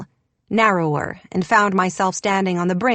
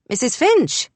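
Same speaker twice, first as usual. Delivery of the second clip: calls across the house, raised voice; calling out; 6 semitones higher than usual. A narrator's reading. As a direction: call her name into the empty house. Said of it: "he called out".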